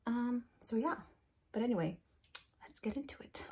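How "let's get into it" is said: soft whispered aside; whispering. Real, not acted. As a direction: whisper it to the camera. Repeats intro line whispered.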